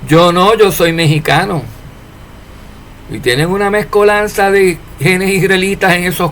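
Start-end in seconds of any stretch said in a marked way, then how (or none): none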